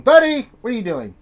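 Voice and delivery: high, loud call to the dog; real sing-out across the room; calling a pet. Real, not acted. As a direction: call out playfully to your pet. Calls to pet who wandered in.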